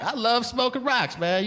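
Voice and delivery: high, enthusiastic call to the back seat; crackhead driver. Acted. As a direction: imitate a gleeful driver talking over his shoulder. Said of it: "this is while he's driving".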